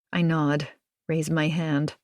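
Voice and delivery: usual speaking voice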